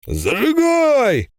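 high, drawn-out commanding cry; a wizard's urgent order; Merlin shouting. A narrator's reading. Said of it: "Первый был голос Мерлина". (крик Мерлина)

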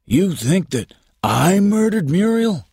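high, indignant outburst; angry. Acted. Unhappy husband to the policeman, angrily. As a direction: pitch soars in outraged disbelief. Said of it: "he said angrily to Patton".

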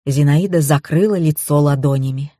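ordinary delivery

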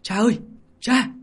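high, loud, rising cry; shouting. Acted. Surfacing in the river, he calls for father, shouting. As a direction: yell out, building to the last call. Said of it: "cất tiếng la".

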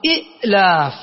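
إئلاف with short i, a glottal stop and a gap; original two-hamza form. Shown as a demonstration. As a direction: cut off the first syllable of إئلاف on the hamza. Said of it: "أصلها".

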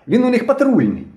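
put-on high-pitched excited boy accusing; shouted accusation. A narrator's reading. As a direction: denounce them in a high, urgent voice. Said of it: "Білобрисий закричав".

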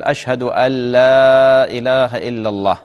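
أن لا merged into "allaa", long held; slow call, say أن لا as "al-laaa" with no noon; correct merged shahada. Shown as a demonstration. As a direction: stretch أن لا as "allaaa" in the adhan phrase. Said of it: "تشديد".

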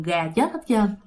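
ordinary delivery

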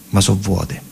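ordinary talk, nothing marked

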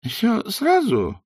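thin, high, slow query; weak voice. A narrator's reading. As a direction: ask it faintly, overwhelmed. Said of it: "слабым голосом уточнил трактирщик".

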